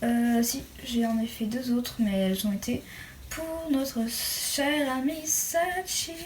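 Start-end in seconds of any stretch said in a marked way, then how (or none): 3.3-6.1 ironie appuyée. Real, not acted. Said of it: "Faut que je me calme".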